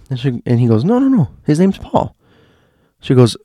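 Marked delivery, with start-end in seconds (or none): 0.8-2.1 high, insistent, brushing it off; insistent doctor. Retold or imitated. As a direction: insist like it's obvious.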